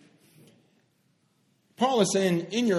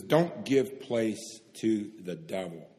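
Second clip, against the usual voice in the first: slow scripture reading; 7 semitones lower, 6 dB quieter, slower than usual. Acted.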